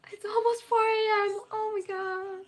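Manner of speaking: shocked realization. Real, not acted. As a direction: drag it out in disbelief. Noticing the late hour.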